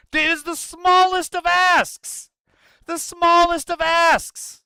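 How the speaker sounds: high, loud, slow, smallest drawn out; stress on smallest. Real, not acted. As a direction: lean hard on smallest in disbelief. Outraged emphasis on simple demand.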